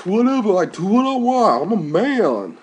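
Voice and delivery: high, loud mock tough-guy boast; mocking macho guys. Mocking the show's guys to viewers in a loud, high boast. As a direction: ham up the swaggering guy. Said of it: "and they're like".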